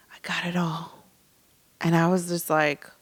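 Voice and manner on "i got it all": soft whisper. Retold or imitated. Surgeon reassuring softly.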